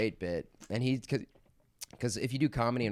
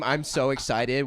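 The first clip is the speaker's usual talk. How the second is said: put-on drawn-out, louder childish glee; slow mock excitement; 5 semitones higher, 6 dB louder, slower than usual. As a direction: gush it slowly like a thrilled kid. Batman voice in acted bit.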